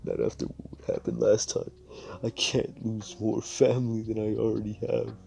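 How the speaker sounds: weep and plead at once; a broken, whispery crying voice; sobbing plea. Acted. Crying over dead parents.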